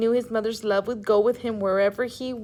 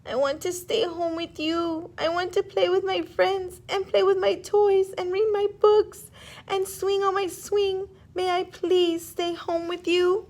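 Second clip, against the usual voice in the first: put-on much higher, pleading child voice; whiny little raccoon; 9 semitones higher than usual. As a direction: beg like a crying little kid. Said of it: "and cried".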